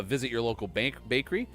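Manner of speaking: usual speaking voice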